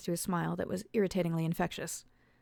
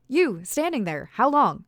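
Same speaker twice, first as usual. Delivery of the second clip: slow, higher and louder demand; demanding shout; 6 semitones higher, 9 dB louder, slower than usual. A narrator's reading. Alice demands an answer from the stranger, shouting. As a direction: press him hard for an answer.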